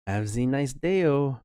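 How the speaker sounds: slow, high and drawn out; sing-song farewell. Pretend. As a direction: stretch it out sweetly. Mock farewell to game character.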